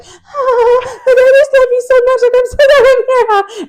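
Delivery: very high, loud squeal; gushing mock affection. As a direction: squeal it while clinging to a friend. Imitating the choker's gushing affection.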